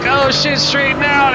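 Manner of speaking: high, urgent cry of alarm; alarmed yelp. Retold or imitated. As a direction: yelp it as the car slides. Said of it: "I was like".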